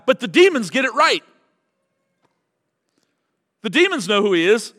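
loud, high, emphatic declaration; big loud preacher's climax; preaching at full force. Real, not acted. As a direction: proclaim it to the back row. Climactic sermon point.

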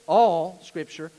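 "All" hit loud and held long; stress on ALL. Real, not acted. Preacher answering his own question, heavy stress on "All". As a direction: answer emphatically with a stretched "All". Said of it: "how much of it?".

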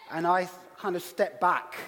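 ordinary talk, nothing marked

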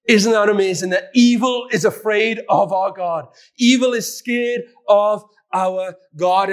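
rising preacher's fervor; fervent preaching. Real, not acted. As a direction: preach it with wonder. Preaching emphasis.

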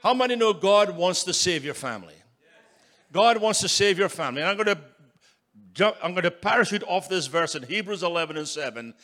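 building revival preaching; full-force preaching. Acted. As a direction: rouse the congregation.

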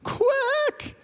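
put-on very high duck quacks from a crying little girl; high pitched tearful duck quack. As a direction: squeak out quacks like a sobbing child playing a duck. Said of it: "she's standing there going".

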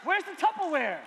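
loud, rising exasperated yell about the tupperware. Retold or imitated. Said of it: "I'm like".

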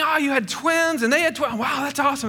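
high, amused mock-impressed voice; mocking neighbours.

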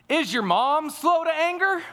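high-pitched teasing question; dad teasing the kids at the dinner table, high and playful. Retold or imitated. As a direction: poke fun at mom with a high sing-song ask.

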